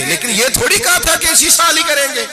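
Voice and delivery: preacher voicing Abu Bakr's angry rebuke. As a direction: scold him sharply, punching each کریں گے. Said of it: "ابو بکر صدیق غصے میں آ گئے".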